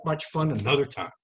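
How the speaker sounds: ordinary talk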